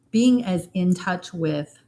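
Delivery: ordinary talk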